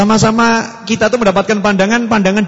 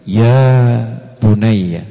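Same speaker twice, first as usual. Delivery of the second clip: gentle call; 7 semitones lower, much slower than usual. Shown as a demonstration. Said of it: "Coba kalau didengarkan tuh".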